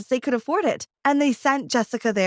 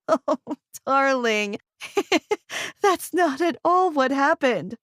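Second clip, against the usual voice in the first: talking through laughter; mother laughing at the misunderstanding. A narrator's reading. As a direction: giggle while explaining to the daughter. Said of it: "wiped tears of laughter".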